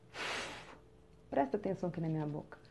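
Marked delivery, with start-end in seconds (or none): 0.1-0.5 long voiceless blown "f"; letter F sound. Shown as a demonstration. Teacher demonstrates the letter's sound to pupils, pure sustained "f". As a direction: blow air steadily on "f", no vowel. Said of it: "o som dela é".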